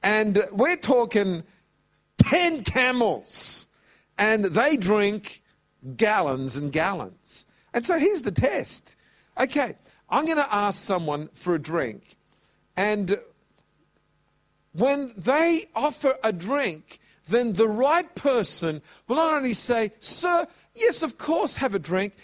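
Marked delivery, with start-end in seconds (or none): 0.0-7.5 slow emphasis. Acted. Emphatic slowed preaching. 20.0-22.1 sudden high, eager sir yes; acting out an overeager helper; eager reply. Eager servant-girl reply acted.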